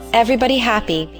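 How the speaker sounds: ordinary delivery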